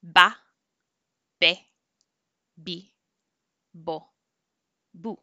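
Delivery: "Ba, be, bi, bo, bu" all with a b sound; b syllables. Shown as a demonstration. Teacher drills b syllables after explaining B and V. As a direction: say each with a plain b, never a v. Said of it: "They both sound like a B".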